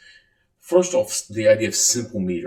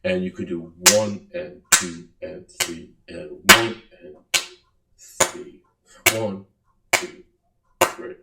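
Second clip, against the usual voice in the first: one stressed, two and three light; slow triple counting demonstration; 3 dB louder than usual. Shown as a demonstration. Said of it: "three beats per measure".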